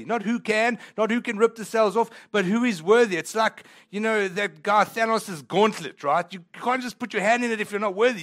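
ordinary delivery

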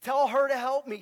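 loud, high, exasperated outburst; Martha's loud, exasperated complaint to Jesus; angry complaint. Retold or imitated. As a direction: vent at Jesus, fed up. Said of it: "She's angry".

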